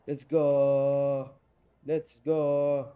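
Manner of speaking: loud, slow, stretched-out vowels; drawn-out cheer. Real, not acted. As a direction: stretch it out in triumph. Celebrates getting through.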